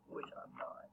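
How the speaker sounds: voiceless whisper; under the breath; whispered aside. Real, not acted. Vlogger whispers a self-mocking aside to camera.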